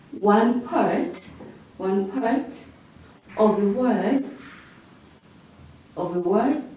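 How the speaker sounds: measured words with long gaps for note-taking; slow dictation. Shown as a demonstration. Teacher dictating a definition to students, slow dictation. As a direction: dictate slowly, phrase by phrase.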